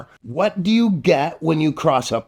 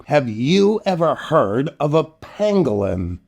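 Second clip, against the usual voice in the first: ordinary delivery, nothing marked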